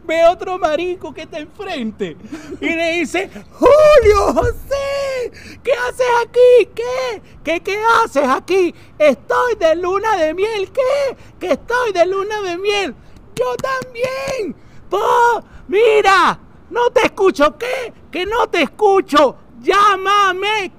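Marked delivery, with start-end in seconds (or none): 3.5-19.4 drawn-out, high balcony-to-balcony call. Acted. Honeymooner to a friend on the opposite balcony, calling out. 19.6-20.7 higher call across a distance. Acted. Honeymooner to a friend who cannot hear, calling out.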